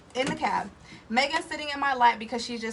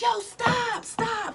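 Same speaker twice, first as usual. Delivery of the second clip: high retold yell at the driver; yelling stop; 12 semitones higher, much slower than usual. Telling the cab driver to stop before the wall, high yell. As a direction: shout him to a stop. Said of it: "like, yelling at him".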